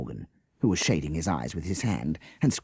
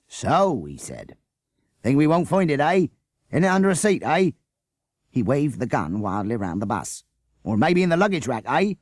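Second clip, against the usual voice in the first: higher, louder mocking policeman; taunting policeman; 8 semitones higher, 5 dB louder than usual. A narrator's reading. Policeman waving his gun at the bus passengers, taunting. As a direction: jab each eh at them like a trap sprung.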